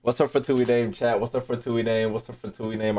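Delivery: normal talking voice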